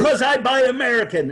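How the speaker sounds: high, loud phone outburst; mock angry yell. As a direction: act out the parting shot before slamming down the phone. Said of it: "he slams the phone".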